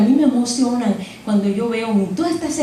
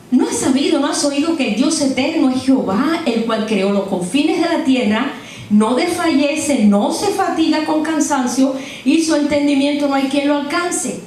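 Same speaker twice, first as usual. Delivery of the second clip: scripture reading; 5 semitones higher than usual. Acted. Preacher reading Isaiah to the church, solemn and raised. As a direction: declaim the verses to the congregation.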